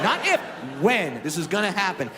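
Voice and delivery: Not if, when. with "when" hit hard; stress on when. Shown as a demonstration. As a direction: punch "when" over "if". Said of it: "Key word there".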